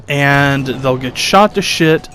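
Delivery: ordinary talk, nothing marked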